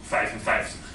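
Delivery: "vijfenvijftig" with every V said as a voiceless F; three hard Fs in "vijfenvijftig"; "vijfenvijftig" said like "faifenfaiftig"; Dutch numeral with Fs. Shown as a demonstration. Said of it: "just three Fs".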